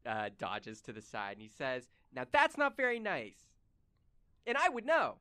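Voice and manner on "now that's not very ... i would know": high, smug and mocking; smug high NPC taunt. Dodging the kick, a boy taunts the player in a high smug voice.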